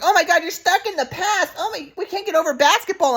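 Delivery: high put-on mom voice; mocking mom impression. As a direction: mimic mom high and scornful. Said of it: "but then my mom's like".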